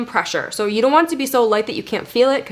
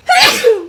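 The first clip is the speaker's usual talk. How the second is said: sudden loud sneeze; 13 semitones higher, 8 dB louder than usual. Real, not acted. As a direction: let a real sneeze burst out. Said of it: "Ooh, I have to sneeze".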